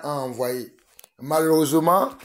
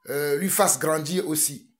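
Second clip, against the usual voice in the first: usual speaking voice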